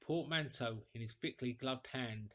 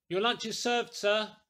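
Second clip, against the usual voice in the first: louder and higher, calling across the room; called out; 7 semitones higher, 7 dB louder than usual. A narrator's reading. Landlady announcing lunch to the silent stranger, calling out. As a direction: call it out pointedly to get his attention. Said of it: "and called, rather than said to him".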